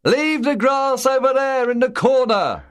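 shouting over wind. A narrator's reading. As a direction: yell over the howling wind. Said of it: "Buster shouted over the noise".

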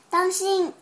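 sudden loud warning shout. Acted. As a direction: yell out a warning. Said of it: "福瑞德大喊".

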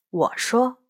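ordinary delivery

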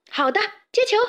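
high-pitched teammate's shout-back; calling back. Acted. Teammate passes the ball mid-match, calling back high. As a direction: call back high as you pass.

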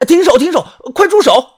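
loud, high, alarmed yell; urgent shouted command; panicked shout. A narrator's reading. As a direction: cry out frantically to stop an attack. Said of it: "我慌忙大喊".

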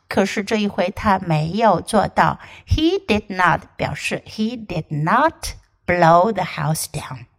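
ordinary talk, nothing marked

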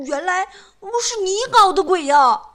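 high, loud indignant cry; angry. Acted. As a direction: accuse her in outrage. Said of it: "他生气地说".

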